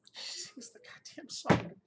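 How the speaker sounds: high, whispery, voice giving out; overcome with emotion. Real, not acted. Man recalling her singing to him, overcome. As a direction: repeat it as the voice fails you. Tearful retelling, voice gives out.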